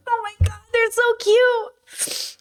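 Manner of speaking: very high, slow crying voice; overwhelmed and weeping; real crying. Real, not acted. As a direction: let it break into tears. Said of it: "Oh, I'm gonna cry".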